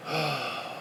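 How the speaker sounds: long, quiet exhale; exasperated sigh. Real, not acted. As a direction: let out a low, weary sigh. Exasperated pause in rant.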